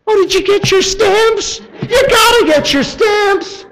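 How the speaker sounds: very high, loud, slowed squeal; shrieking delight over store stamps; gushing shopper. Retold or imitated. Imitating a shopper excited about stamps.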